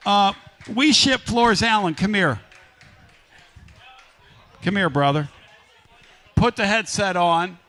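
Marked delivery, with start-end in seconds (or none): none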